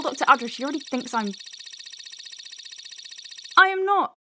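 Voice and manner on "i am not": indignant denial. Acted.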